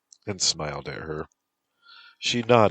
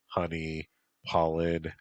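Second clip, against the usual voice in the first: ordinary delivery, nothing marked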